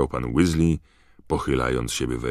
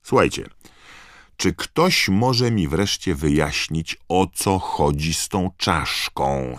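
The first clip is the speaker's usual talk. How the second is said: impatient; 4 semitones higher, 5 dB louder, slower than usual. A narrator's reading. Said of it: "spytał niecierpliwie Ron".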